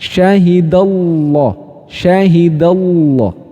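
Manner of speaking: Syahidallah and Allah with a full, pressed, dark lam, slow; slow heavy tafkhim Allah; pressed thick. Shown as a demonstration. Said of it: "Posisi lidahnya tuh ditekan".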